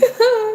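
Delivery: quick high chuckle; brief, high-pitched happy laugh. Real, not acted. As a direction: let a short giggle slip out. Laughs while promising a party.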